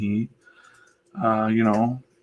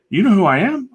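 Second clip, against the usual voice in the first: proud guest; 12 semitones higher, 7 dB louder than usual. Retold or imitated. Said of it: "He's like".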